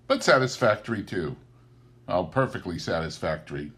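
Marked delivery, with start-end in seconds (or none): none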